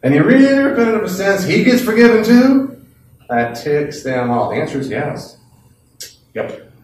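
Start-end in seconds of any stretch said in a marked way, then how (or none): 0.0-2.8 high, loud incredulous challenge; skeptical atheist. Acted. Skeptic challenging the preacher, loud disbelieving scoff. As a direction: scoff at the very idea. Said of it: "atheists are skeptical".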